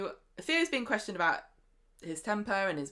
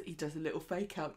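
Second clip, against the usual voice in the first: ordinary talk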